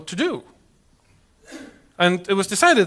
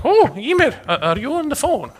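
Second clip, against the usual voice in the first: surprised relative; 8 semitones higher than usual. Retold or imitated. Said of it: "picked up the phone and was like".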